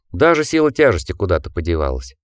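ordinary delivery

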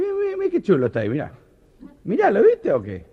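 very high, fast excited Argentine driver; Argentine accent. Acted. Driver pointing the parrot out to his mate, excited Argentine accent. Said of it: "contarlos con acento argentino".

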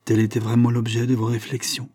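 plain reading voice

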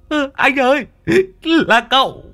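Long whines to his boss that the master told him to hit; pleading whine. Acted. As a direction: whine to shift the blame.

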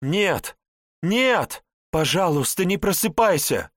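high, loud nightmare cry; screamed plea. A narrator's reading. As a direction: scream it desperately into the sleeper's ear. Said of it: "прямо в ухо кричит".

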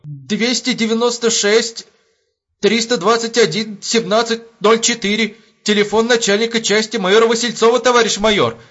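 high, panicky military report; frightened, crisp recital. A narrator's reading. Shaken sentry reports the number to the major, frightened. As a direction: rap out each number in fear. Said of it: "отчеканил испуганный караульный".

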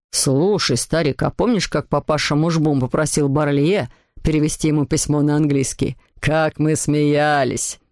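deep voice of a grown man; father reminiscing. A narrator's reading. Father reminisces with his old friend, in a deep voice. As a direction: chat to an old pal, pitched low, drawing out the end.

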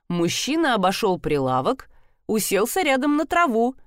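ordinary delivery

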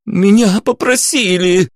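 high, drawn-out wail; desperate high-pitched howl. A narrator's reading. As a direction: wail the confession in panic. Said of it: "взвыл Лёша".

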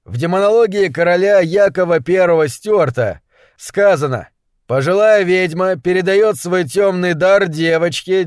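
solemn public pronouncement; proclaiming. A narrator's reading. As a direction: announce a ruling to a whole crowd. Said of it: "и провозгласил".

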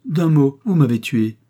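loud, high cry of despair; anguished outcry. A narrator's reading. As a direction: throw the words out as if wounded. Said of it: "s'écria le jeune homme".

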